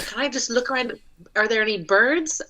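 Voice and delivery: normal talking voice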